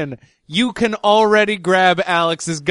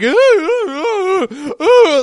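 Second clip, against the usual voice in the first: high, hooting "ooh" of fake fright; mock squeamish wailing; 10 semitones higher, 5 dB louder, much slower than usual. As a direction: put on an exaggerated scaredy-cat whimper. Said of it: "whatever Alex Jones is making fun of".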